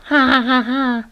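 high mocking ha-ha laugh; hyena's laugh. A narrator's reading. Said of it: "the hyena laughed again".

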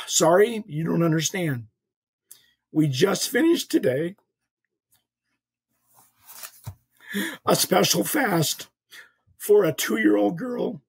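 retold tearful bishop, slow and halting; sigh, then imitate someone gathering himself, with long pauses; regaining composure after crying. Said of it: "takes him a while to bring his composure back".

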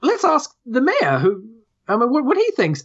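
high, hesitant squirrel chatter; the squirrel defers to the mayor, high and unsure. Acted. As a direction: pipe it up like a dithering little squirrel. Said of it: "the squirrel says".

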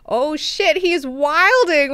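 shocked outburst. Retold or imitated. Own shocked reaction retold.

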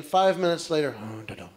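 ordinary talk, nothing marked

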